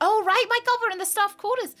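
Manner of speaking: sudden high, loud burst of excitement; excited. Real, not acted. Gamer spotting the staff quarters, excited high exclaim. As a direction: jump up with delight at the new room.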